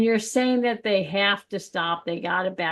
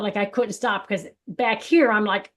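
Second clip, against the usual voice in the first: normal talking voice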